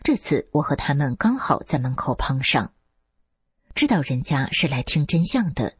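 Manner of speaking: ordinary delivery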